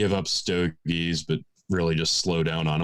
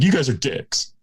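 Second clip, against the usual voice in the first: raised, higher heckle; mock indignant shout; 8 semitones higher, 5 dB louder than usual. A listener heckling the people they're hearing, mock indignant. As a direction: playfully yell back at the speakers.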